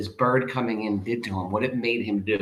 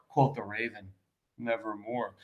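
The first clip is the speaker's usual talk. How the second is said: hushed raven; 2 semitones lower, 10 dB quieter than usual. Acted. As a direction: let the raven answer almost under the breath. Raven's reply.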